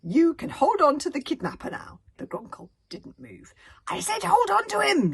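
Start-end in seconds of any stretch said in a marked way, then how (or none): 0.0-2.0 higher and louder, lightly voiced commanding prefect; prefect's bad Dragonese. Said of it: "terrible accent in Dragonese". 3.9-5.1 much higher and louder cross shout. Acted. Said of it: "shouted the thin prefect crossly".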